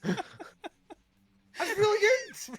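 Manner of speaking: very high, put-on granny voice. Friend doing a silly bit for laughs, high gran voice. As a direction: squeak it out like a Scottish grandma. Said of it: "Sounds like my gran a wee bit".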